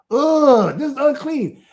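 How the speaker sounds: high, loud ugh, then falling; mock a child's disgusted verdict; imitated disgust. Father imitates children rejecting unclean food, mock disgust. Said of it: "That's what they're saying".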